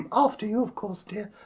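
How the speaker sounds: high polite queen voice. Acted. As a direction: defer sweetly as the queen.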